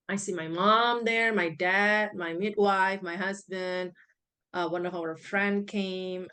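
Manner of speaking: ordinary delivery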